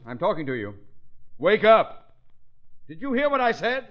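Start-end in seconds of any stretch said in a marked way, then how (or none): 1.4-3.9 rising shout to wake a sleeper. Acted. Calling dozing board member.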